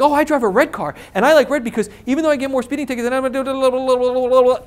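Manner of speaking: high, fast rambling that runs off into babble; mock verbal diarrhea. Scared buyer over-answering a seller's question, mock rambling. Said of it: "that's verbal diarrhea".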